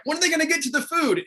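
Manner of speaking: impatient viewers. Retold or imitated. Host teasing the audience waiting for the food, quick mock-impatient. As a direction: toss it off like a restless fan wanting food.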